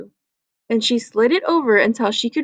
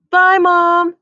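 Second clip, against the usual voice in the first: bright call toward the kitchen, higher and louder; 6 semitones higher, 5 dB louder than usual. Acted. Boy calling goodbye to his mom, a bright call. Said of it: "Andrew called".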